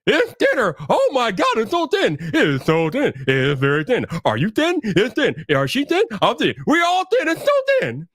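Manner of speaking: high, swooping parody of gushing online fans; mocking hype imitation. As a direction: mimic silly awe, pitch jumping up and down. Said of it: "the only thing that they seem to be saying".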